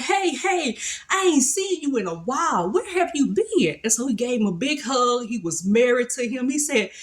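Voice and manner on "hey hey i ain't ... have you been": a hint of an excited old man, pitched higher; lightly voiced father-in-law calling hey, hey; happy father-in-law greeting. Father-in-law welcoming his son-in-law back, lightly voiced and cheerful.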